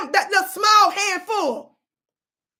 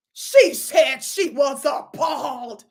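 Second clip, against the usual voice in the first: slow, drawn-out emphasis on each word; slow mocking quote; 3 semitones lower, slower than usual. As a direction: stretch out each word in mock disbelief. Mockingly repeats the chief's statement.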